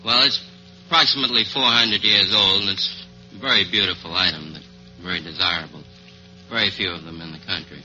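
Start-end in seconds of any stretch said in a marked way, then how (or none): none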